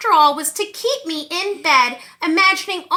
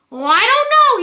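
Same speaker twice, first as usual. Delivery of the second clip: a touch of spooky menace, louder and higher; hammed-up scary monster teasing; mock terrifying monster; 7 semitones higher, 6 dB louder than usual. Monster trying to scare the kid, mock menace. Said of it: "trying to sound terrifying".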